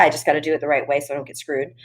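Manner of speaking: ordinary delivery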